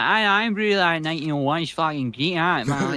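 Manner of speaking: loud, high, broad Scottish raving; full-blown Scots. Retold or imitated. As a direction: go all out in thick Scots, loud. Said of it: "we call it the Scottish vortex".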